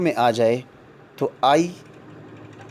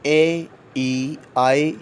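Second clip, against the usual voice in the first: slow listing; slower than usual. Shown as a demonstration. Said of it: "vowel sound میں او کی".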